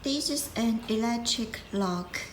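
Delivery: normal talking voice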